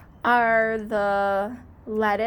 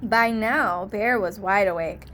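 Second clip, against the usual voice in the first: ordinary delivery, nothing marked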